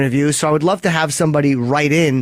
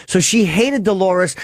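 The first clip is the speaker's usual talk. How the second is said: normal talking voice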